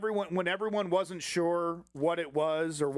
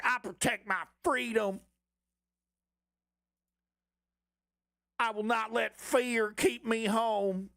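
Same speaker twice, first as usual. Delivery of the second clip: high, slow, pompous mock patriot; mock slogan voice; 5 semitones higher, slower than usual. Mocking right-wing posters in a high, slow pompous voice. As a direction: ham up the defiant slogan, pitched up.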